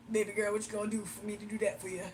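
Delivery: put-on groggy old man. Imitating a creepy old man leering at her mother. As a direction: drop low and leer like an old man. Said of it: "an old groggly man talking".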